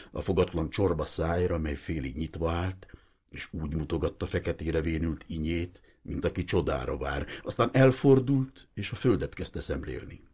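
ordinary delivery, nothing marked

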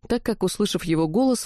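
ordinary delivery

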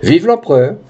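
obliging cry with a lift at the end; candidate's echo. Acted. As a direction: parrot the imperial cheer back. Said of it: "Le candidat répondit".

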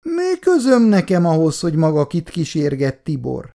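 put-on an angry young woman's retort; flaring up. A narrator's reading. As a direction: flare up at him, offended. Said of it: "fortyant föl újra Icu".

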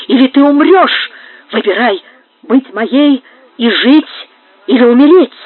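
loud, forceful threat with pauses; hissed threat. A narrator's reading. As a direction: press out the ultimatum menacingly. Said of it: "прошипел Карлос".

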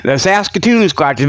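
ordinary talk

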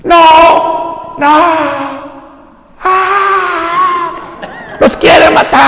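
high squealing protest; mock whine. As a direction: squeal it like a scared crowd. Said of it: "mocking the Israelites". (chillido burlón)

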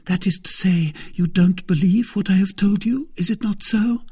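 put-on high, eager young servant girl; timid maid reassuring a visitor. Kitty voiced high.

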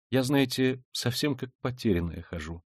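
plain reading voice

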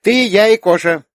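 put-on high, loud child's voice; small girl counting them off brightly; emphatic little girl. A narrator's reading. Little girl counts aunt, herself and cat, emphatic.

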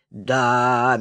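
low, drawn-out musing; dreamy drawl. A narrator's reading. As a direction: stretch it out dreamily. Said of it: "мечтательно протянул Пупсик".

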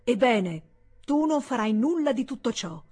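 loud, rising outburst; angry exclamation. Acted. The senator rising to forbid his brother, loud and angry. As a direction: forbid it as he rises from the table. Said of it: "esclamò il senatore".

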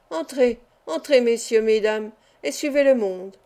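ordinary delivery

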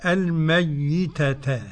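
الميتة slowly as al-mayyitata, doubled ya; Abu Ja'far reading. Shown as a demonstration. Sheikh showing Abu Ja'far's reading, slow doubled ya. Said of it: "قرأ أبو جعفر فقط لوحده كده".

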